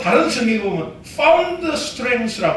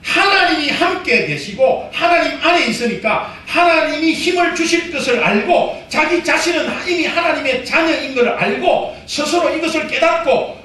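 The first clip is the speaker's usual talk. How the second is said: ordinary talk, nothing marked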